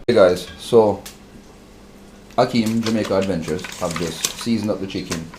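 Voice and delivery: ordinary delivery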